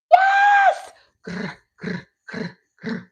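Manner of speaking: shrieked high yes, then growls; Chloe Sevigny impression. Comedian showing her Chloe Sevigny impression, squeal then growl. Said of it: "This is my impression of Chloe Sevigny and kids".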